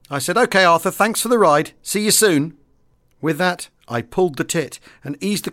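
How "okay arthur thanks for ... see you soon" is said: higher, raised cheery sign-off; lightly acted reply over the intercom; calling back. Pilot answers skipper over intercom.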